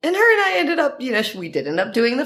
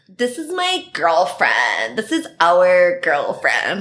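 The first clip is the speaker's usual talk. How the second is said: proud introduction; slower than usual. Retold or imitated. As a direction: announce it proudly to the room. Friend introducing her at bars.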